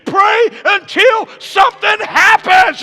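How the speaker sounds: high, loud shouted exhortation building in intensity; full-force preaching. Real, not acted. Preacher urging congregation to push, fervent shouting.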